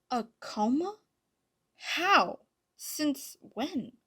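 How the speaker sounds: high, loud, startled questions; flabbergasted. A narrator's reading. Adrien learning of his mother's coma, flabbergasted. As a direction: fire startled questions, pausing between each.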